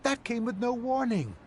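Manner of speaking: high, startled outburst; shocked. Acted. Friend hearing the theater will be torn down, shocked. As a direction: caught off guard by bad news.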